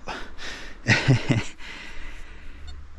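quiet airy chuckle; breathy laugh under the breath; soft breathy laugh. Real, not acted. Laughs at own clumsiness.

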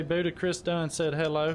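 ordinary delivery

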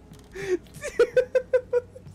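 high, breathy squeals of laughter; wheezy high giggle; laughing. Real, not acted. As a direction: crack up helplessly. Cracks up after chase.

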